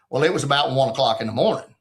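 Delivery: usual speaking voice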